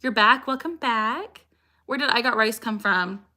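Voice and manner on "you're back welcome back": usual speaking voice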